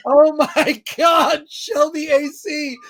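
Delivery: real laughter breaking into a slow, high, loud "oh my God"; laughing shout. Real, not acted. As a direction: blurt it out mid-laugh, stretched and loud. Stunned by a joke title.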